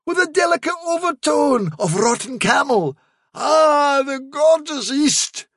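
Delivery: very high, swooning admiration; enraptured guard dog. Acted. Hound sniffs a rotten smell as if perfume, rapturously. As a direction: savour every word in ecstasy.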